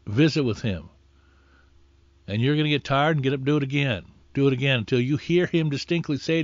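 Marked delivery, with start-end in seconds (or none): none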